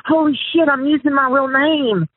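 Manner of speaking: the jolt of catching your own mistake; alarmed realization. Retold or imitated. Prankster recalling the moment he realized he used his real name, alarmed.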